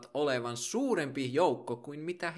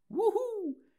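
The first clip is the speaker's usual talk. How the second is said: whoop. Acted. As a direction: cheer like a fan at a concert. Cheer after each patron thanked.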